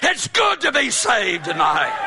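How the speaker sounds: high, shouted revival preaching; preaching at full force. Acted. Preacher to his congregation, preaching at full force with a high shout. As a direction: ring it out like a preacher at the altar call.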